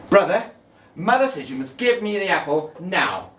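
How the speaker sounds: high, loud little sister demand; little sister ordering her brother; demanding child. Acted. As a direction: insist like a bossy little girl. Girl demanding again.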